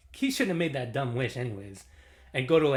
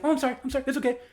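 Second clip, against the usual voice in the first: high, hurried mock apology; imitated frantic apology; 11 semitones higher, much faster than usual. Imitating the woman soothing her boyfriend, high frantic apology.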